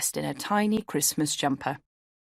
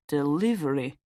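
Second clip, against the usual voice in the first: conspiratorial. A narrator's reading. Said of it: "looking at me conspiratorially".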